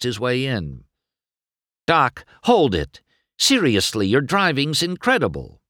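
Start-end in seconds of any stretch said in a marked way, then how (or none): 1.9-5.6 eager. Acted. McQueen chases Doc into office.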